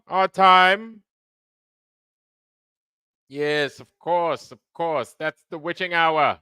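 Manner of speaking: lightly put-on mid-Atlantic accent, a bit high; mock mid-Atlantic accent for the witching hour. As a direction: play a grand old-film host in mid-Atlantic style. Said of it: "in my mid-Atlantic American accent".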